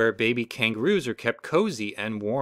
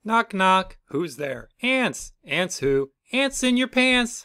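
silly knock-knock joke; 8 semitones higher, 3 dB louder, slower than usual. Acted. Children telling a knock-knock joke.

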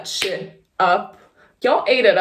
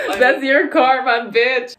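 laughing taunt; 5 semitones higher, 4 dB louder than usual. Real, not acted. Mocking own past self, laughing.

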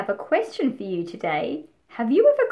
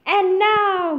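high, loud triumphant call; excited cry; 8 semitones higher, 4 dB louder than usual. Acted. Max crying out to start the rumpus, high and loud. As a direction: announce the party like a new king. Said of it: "cried Max".

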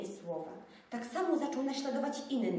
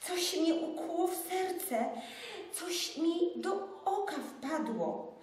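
dużo wyższy, dziecięcy głos, wolno; skarżący się chłopiec; 9 semitones higher than usual. Acted. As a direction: zagraj chłopca, któremu coś wpadło do oka. Jaś skarży się na ból. (wysoki głos zaskoczonego chłopca)

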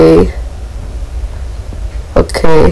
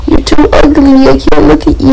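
much higher, disgusted squeal; 8 semitones higher than usual. Real, not acted. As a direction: recoil from the ugly monster. Reacting to monster's face.

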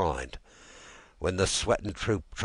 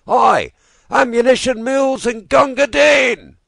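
long, loud, much higher held shout; front-line shout; 15 dB louder than usual. Acted. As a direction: bellow down the line for ammunition.

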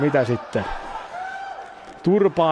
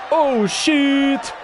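startled high exclamation; real surprise; 10 semitones higher than usual. Real, not acted. Streamer startled by a sudden move in the match.

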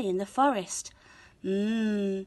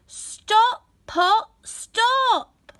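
much higher, louder firm command; shouted spell; 13 semitones higher, 5 dB louder, much slower than usual. A narrator's reading. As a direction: shout the pot to a stop. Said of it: "Hannah shouted the magic words".

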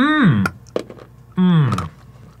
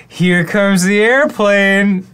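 airplane spoon game; 7 semitones higher, 4 dB louder, much slower than usual. Pretend. Feeding partner a spoonful.

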